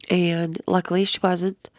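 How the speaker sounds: usual speaking voice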